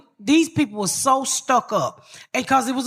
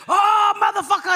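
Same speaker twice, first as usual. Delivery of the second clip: imitated crackhead holler; 8 semitones higher than usual. Imitating a crackhead beating on the window, mock holler. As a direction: holler like someone banging on a window. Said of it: "The crackhead was beating on the window".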